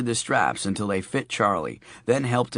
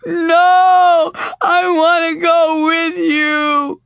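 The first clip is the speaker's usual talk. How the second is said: very high, loud screaming sob; crying child; 11 dB louder, slower than usual. A narrator's reading. Sophie cries as she's taken from her father, in a high wailing scream. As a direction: scream through tears as she's carried off.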